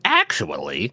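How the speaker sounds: low, drawn-out mock "actually"; nitpicking corrector. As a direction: correct them like a pedant in a put-on low voice. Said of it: "anybody out there is like".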